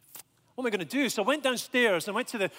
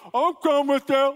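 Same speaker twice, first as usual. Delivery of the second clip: loud, high, slow angry growl through a mouthful; exaggerated imitation of a furious volunteer; angriest young man; 8 semitones higher, 10 dB louder, slower than usual. Angry short guy volunteering with sandwich in mouth, mock furious. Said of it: "the angriest young man I've ever come across".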